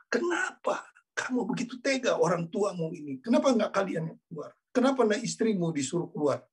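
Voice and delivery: high, tearful pleading voice; a retold sob-laden plea; mock crying question. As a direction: ask through mock tears. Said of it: "Dia nangis, nangis".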